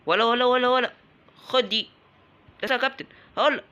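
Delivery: high, loud fight cries; shouting at the enemy in the heat of battle; excited shouting. Real, not acted. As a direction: hype yourself up mid-fight. Fight heats up.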